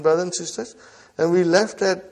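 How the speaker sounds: ordinary talk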